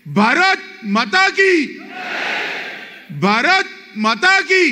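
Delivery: high, slow roaring chant; roaring rallying speech. Shown as a demonstration. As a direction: roar it to rouse the crowd. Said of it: "प्रचंड आवाज से बोलिए".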